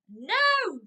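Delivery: put-on high, loud defiant child's "No!". Shown as a demonstration. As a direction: shout it like a stubborn toddler. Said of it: "expect them to answer with a no".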